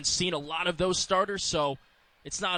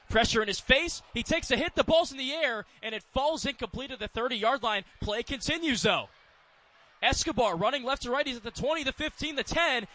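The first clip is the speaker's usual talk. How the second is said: higher-pitched, urgent football call; excited play-by-play; 6 semitones higher than usual. Real, not acted. Announcer follows a loose ball run back, excited play-by-play.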